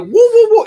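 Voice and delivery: very high, loud dog woops; Curly. Retold or imitated. Imitating his dog barking at everybody, high loud comic woops. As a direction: yelp like a slapstick stooge. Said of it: "he sounds like Curly from the Three Stooges".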